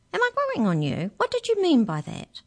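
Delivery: high-pitched, insistent questioning; questioning boy character. Acted. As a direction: pitch right up, wanting an explanation. Boy questions mouse's remark.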